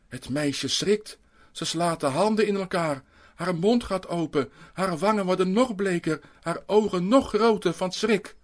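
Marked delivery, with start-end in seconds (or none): none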